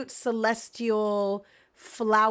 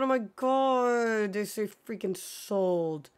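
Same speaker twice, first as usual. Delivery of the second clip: ordinary talk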